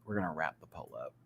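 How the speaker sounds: normal talking voice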